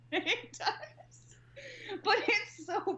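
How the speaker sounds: high-pitched laugh spilling into the words; real laughing. Real, not acted. Agreeing the cheap budget shows, really laughing. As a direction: agree through a fit of giggles.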